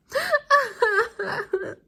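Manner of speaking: very high, loud sobbing wail; wailing sob. A narrator's reading. The crying children wail as Ned flies away. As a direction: sob as loud as you can.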